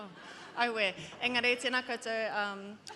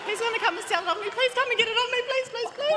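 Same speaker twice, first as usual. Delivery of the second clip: much higher, louder, fast giggly begging; laughing plea; 8 dB louder, faster than usual. Real, not acted. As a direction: beg him through laughter, voice shooting up. Teasing husband walking in, pleading.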